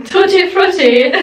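Tutti frutti drawn out, high and loud; playful sing-song. Shown as a demonstration. Repeats flavor playfully.

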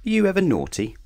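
playful question. A narrator's reading. Narrator asks child playfully.

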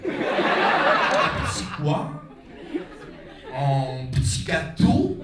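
put-on breathy, slow French accent; mock French. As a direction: ham up a posh French waiter. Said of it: "you gotta speak French".

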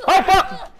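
high, loud swearing outburst; startled yelp. Real, not acted. Player caught out in combat, startled yelp. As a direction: yelp it in alarm.

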